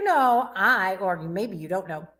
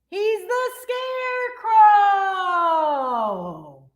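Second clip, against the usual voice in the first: drawn-out reveal; 9 semitones higher, much slower than usual. Pretend. Reveal of the scarecrow.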